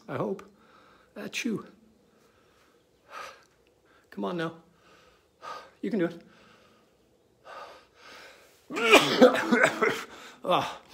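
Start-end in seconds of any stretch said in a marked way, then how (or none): 1.2-1.7 a real high sneeze, achoo; sneezing. Real, not acted. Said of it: "Pardon me, I'm gonna sneeze". 8.7-10.4 a real loud coughing fit; hacking cough, loud. Real, not acted. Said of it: "excuse me".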